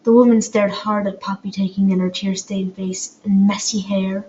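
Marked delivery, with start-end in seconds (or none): none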